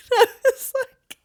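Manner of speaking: high, loud laughing words. Real, not acted. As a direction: laugh right through the words. Bursting out laughing at joke.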